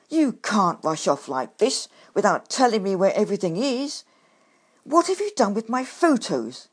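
loud pleading call after the badger; shouting after him. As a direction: call out, demanding answers. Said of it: "to shout after him".

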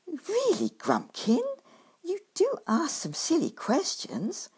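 high, exasperated badger retort; snorting, exasperated. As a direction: talk down to a silly question. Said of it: "he replied with a snort".

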